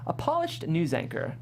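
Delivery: ordinary delivery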